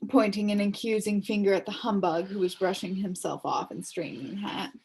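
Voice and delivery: ordinary delivery, nothing marked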